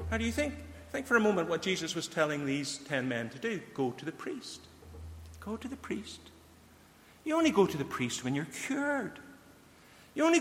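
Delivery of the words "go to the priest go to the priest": hushed musing. Retold or imitated. Echoing Jesus' command, musing aloud.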